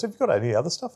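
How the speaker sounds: high, keen question; eager boss. Retold or imitated. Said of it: "she goes".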